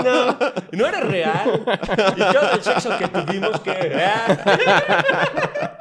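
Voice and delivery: high, slow, laughing fake despair; hammed-up heartbroken cry, cracking up; laughing mock lament. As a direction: wail it through laughter. S1 mocking imagined wife scene laughing.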